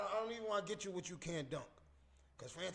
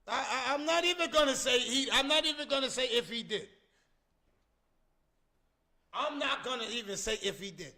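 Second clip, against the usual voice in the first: loud emphatic denial; 8 semitones higher, 11 dB louder than usual. Real, not acted. Loud insistence to viewers.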